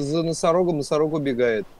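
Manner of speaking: ordinary talk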